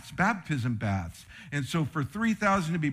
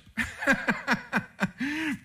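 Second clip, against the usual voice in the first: joyful laugh; 11 semitones higher than usual. Real, not acted.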